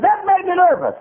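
high, loud mimicked complaint; frantic nervous outcry. Lady telling the preacher about another tract, high frantic cry.

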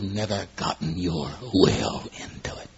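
breathy, slow whisper driving the point home. Real, not acted. Preacher whispers the final point to the congregation. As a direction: lean in and whisper it, slowly. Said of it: "punch this last one in your soul".

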